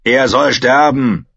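slow, loud, stubborn verdict; insistent. A narrator's reading. Bone lord demands the captive's death, insistently. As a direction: insist on it, drawing it out. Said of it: "beharrte der Lord der Knochen".

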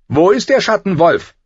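high, loud demanding question; imperious queen. A narrator's reading. Cersei Lannister once her husband left, demanding.

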